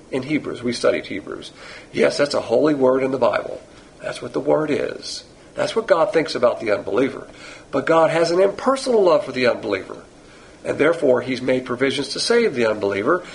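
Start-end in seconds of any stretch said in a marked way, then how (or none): none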